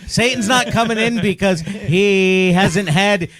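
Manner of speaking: laughing mock Yiddish grumble; Yiddish accent.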